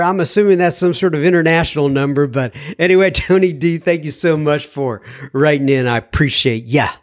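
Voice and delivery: ordinary talk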